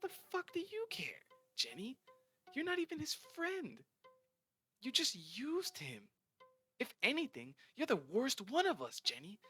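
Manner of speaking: put-on spiteful mean girl. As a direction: tear into her friend. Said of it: "Dina says".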